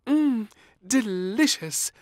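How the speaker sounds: high, slow savouring maid; delighted maid. Acted. Maid tucking into a sandwich, delighted. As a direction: savour the taste. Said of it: "said the maid".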